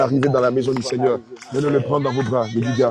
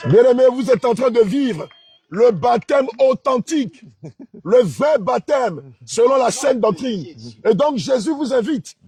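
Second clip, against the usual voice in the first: raised, loud preaching voice; fervent preaching; 11 semitones higher, 4 dB louder, slower than usual. Acted. Pastor preaching the real baptism to the church. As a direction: preach it out with fire.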